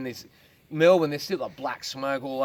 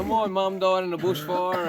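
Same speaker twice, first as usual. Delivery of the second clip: lightly mocking higher-pitched complaint; aggrieved complainer; 6 semitones higher, 4 dB louder, slower than usual. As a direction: drag out the mock grievance slowly, pitched up.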